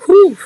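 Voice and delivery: high, loud whew of relief. Real, not acted. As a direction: let out a big high whew. Reacting to remembered spicy food.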